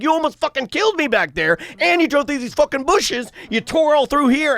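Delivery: high-pitched outraged tirade; retold yelling. Berating the driver over the near miss, retold yelling. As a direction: re-enact a heated list of accusations. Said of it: "And I'm yelling".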